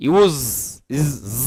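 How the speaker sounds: ইউজ drawn into a long buzzing z; voiced z sound. Shown as a demonstration. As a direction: isolate the z: ইউজ, ইজ, then জ as a buzz. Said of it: "এই সাউন্ডটা, ইউজ". (ইউজ, শেষে টানা ঘোষ জ)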